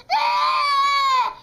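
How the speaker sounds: loud high squeal; excited shriek. Acted. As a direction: shriek with glee. Rabbits chased in child's story.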